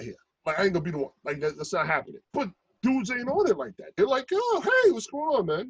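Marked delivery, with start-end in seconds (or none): 4.3-5.7 very high, breezy hello; sincere friendly greeting. Retold or imitated. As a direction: imitate an easygoing, welcoming local. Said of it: "he meant it really".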